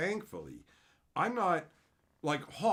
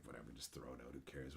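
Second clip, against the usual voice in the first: much softer, tossed-off dismissal; muttered brush-off; 18 dB quieter, faster than usual. Retold or imitated. Streamer showing how she'd dismiss gross mail, muttering. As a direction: shrug it off under your breath.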